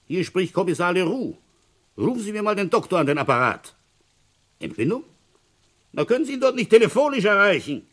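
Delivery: high, loud, insistent telephone talk; loud phone voice. Acted. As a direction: make yourself heard down a crackly line. Telephone call to doctor's house.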